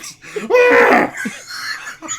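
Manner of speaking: loud wheezing shriek of a laugh, high and unvoiced; a laugh like a dying elephant. Real, not acted. Teammate mocks the laugh as a dying elephant, wheezing shriek. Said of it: "sounds like a dying elephant".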